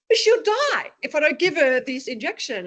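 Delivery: high and loud, pleading; desperate cry. Retold or imitated. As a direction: shout back in desperate panic. Desperate reply to yelling dad.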